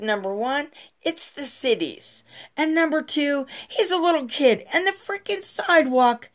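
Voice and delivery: very high, heated retold rebuttal; indignant arguing. Mom telling off the neighbor over her kid, indignant.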